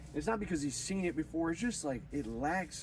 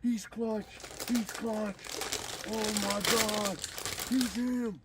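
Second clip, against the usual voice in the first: much higher, squealing hype of watching players; high-pitched awestruck shrieks; excited fan squeal; 9 semitones higher, much slower than usual. Retold or imitated. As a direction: gush like a teen gamer watching the clutch. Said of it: "they were like".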